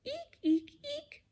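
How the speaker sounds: high squeaks; tiny high eeks; squeaky shoe. Pretend. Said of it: "like a squeaky shoe".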